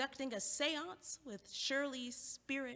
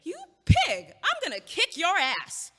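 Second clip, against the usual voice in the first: screaming, much higher and louder; furious shout; 8 semitones higher, 15 dB louder than usual. Acted. Woman lunging at the rude neighbor, furious screaming. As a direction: explode at him with rage.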